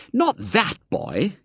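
slow, high scandalised mother; indignant fat woman. A narrator's reading. Georgie's mother objecting to William, high and appalled. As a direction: recoil at the very idea. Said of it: "came in the fat woman's voice".

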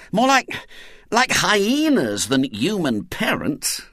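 high then slow, bitter complaint; bitterly. A narrator's reading. Ginger complains bitterly to his friends. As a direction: spit out the comparison bitterly. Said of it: "said Ginger bitterly".

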